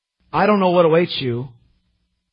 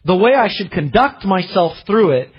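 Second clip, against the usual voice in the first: urgent request; 5 semitones higher, 4 dB louder than usual. Acted. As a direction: beg for guidance with fervour. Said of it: "you hear his request".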